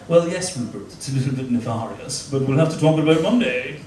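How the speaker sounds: mock posh phone voice, accent softened to be understood. Navy man on the phone to officer friend, softened posh accent. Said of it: "you have to soften your accent".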